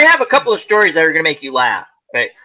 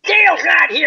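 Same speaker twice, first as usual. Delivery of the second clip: higher, louder "Dale's not here" cried out; upset shout; 11 semitones higher, 3 dB louder than usual. Retold or imitated. Worker shouting at her manager, upset shout. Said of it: "coworker yelling at manager".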